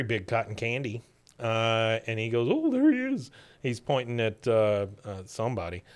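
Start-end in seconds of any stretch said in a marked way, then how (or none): 2.5-3.3 very high, light cartoon exclamation; imitated spotting. Parade watcher pointing someone out, high mock excitement.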